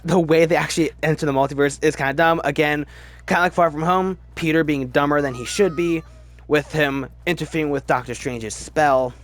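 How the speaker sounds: ordinary talk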